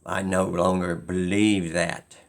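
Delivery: normal talking voice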